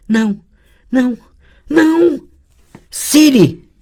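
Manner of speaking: high, loud panicked no's; frightened girl crying for help in a vision; terrified cries. Acted. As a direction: cry out in rising terror.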